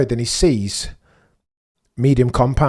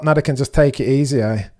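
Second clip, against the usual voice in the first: ordinary delivery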